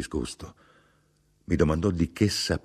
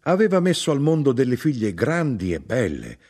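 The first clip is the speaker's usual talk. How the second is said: shouted boast; 8 semitones higher, 4 dB louder than usual. A narrator's reading. Mother shouting her father's greatness, proud boast. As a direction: proclaim it with pride. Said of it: "gridò".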